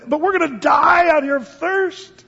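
complaining. Retold or imitated. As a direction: whine it like a thirsty grumbler in the desert. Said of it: "they're kinda complaining".

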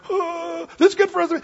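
high-pitched awestruck gasping blurt; babbling Peter. Acted. Peter blurting at the Transfiguration, excited babble. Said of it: "That's what Peter was doing".

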